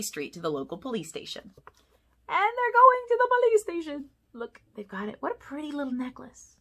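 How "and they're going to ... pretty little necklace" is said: much higher, louder, delighted; excited aside. Acted. Narrator shows kids the picture, much higher and excited. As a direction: point it out with glee.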